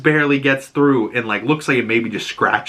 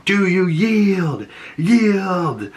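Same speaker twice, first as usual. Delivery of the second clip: high, slow mock yell across distance; imitated yelling; 8 semitones higher, much slower than usual. Arian across the field demanding Dunk yield, mock yelling. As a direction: call it out long and high, demanding surrender. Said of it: "yelling at him".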